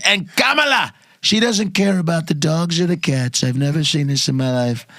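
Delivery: mock Trump piling on absurd claims; exaggerated Trump debate rant; Trump impression. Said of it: "I saw the whole thing on T V".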